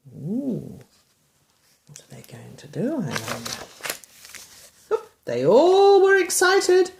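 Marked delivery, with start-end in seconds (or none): none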